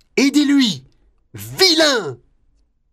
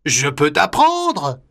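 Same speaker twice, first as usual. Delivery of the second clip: much lower, kindly elephant; deep elephant voice; 8 semitones lower than usual. Acted. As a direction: speak low and friendly. Hati voice.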